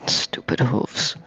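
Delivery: muttered under breath; whispered grumble. Acted. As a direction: whisper it in annoyance. Grumbling about hooves on ice.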